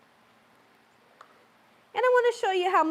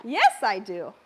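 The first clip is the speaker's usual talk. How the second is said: ordinary talk, nothing marked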